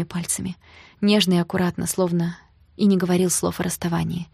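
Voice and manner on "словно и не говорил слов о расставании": ordinary delivery, nothing marked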